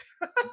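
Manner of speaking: a quick rising laugh, real amusement; brief bright chuckle; short high laugh. Real, not acted. Host laughs at own remark.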